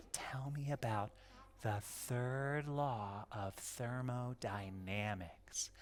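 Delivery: hushed whisper. Shown as a demonstration. Mom hushing her girls before the song, whispering. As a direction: whisper it to settle the kids. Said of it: "Oh, shh".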